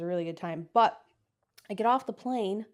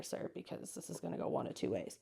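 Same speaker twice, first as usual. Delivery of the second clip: whispered aside, half joking; hushed warning; 11 dB quieter than usual. Retold or imitated. Telling the doctor to be careful, whispered warning.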